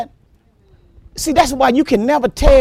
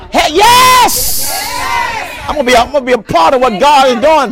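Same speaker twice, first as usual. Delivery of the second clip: eager shout; 5 semitones higher than usual. Acted. Preacher playing a believer who wants in, shouting with joy. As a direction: burst out like a believer who just caught the fire.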